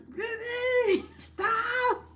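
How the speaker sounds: very high, shrill pretend yell; mock viewers yelling. Streamer imagining viewers shouting at her, high mock yell. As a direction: play the horrified viewers shouting. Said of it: "and you guys are like".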